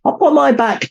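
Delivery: very high, eager call; high snake cry. A narrator's reading. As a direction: urge him to climb on. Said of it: "Jake cried".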